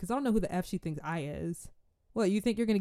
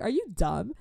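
climbing higher, disbelieving; incredulous; 12 semitones higher than usual. Retold or imitated. Thinking at the rude girl, rising disbelief. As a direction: ask if she's lost her mind. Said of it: "In my head, I'm like".

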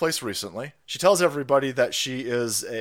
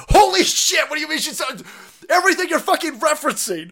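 outburst; 13 semitones higher, 6 dB louder than usual. Real, not acted. Outraged reaction to chat comment.

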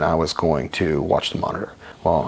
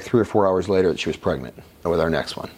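ordinary talk

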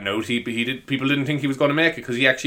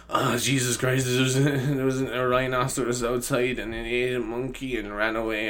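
lightly voiced gullible-bait story, softer; put-on silly voice; 5 dB quieter than usual. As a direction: tell a wild fib in a put-on voice. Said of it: "I don't talk like that".

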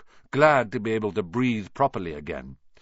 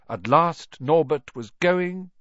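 slow drawn-out build; slow fading; 7 semitones higher, 4 dB louder, slower than usual. A narrator's reading. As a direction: stretch it out. Dragon leaving.

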